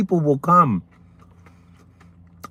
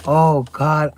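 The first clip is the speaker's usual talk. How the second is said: normal talking voice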